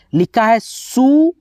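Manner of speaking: सू stretched slowly and rising; drawn-out syllable. Shown as a demonstration. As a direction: drag out सू as a teaching example. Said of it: "जैसे यहाँ पे देखो समझो". (धीरे खींचकर सू)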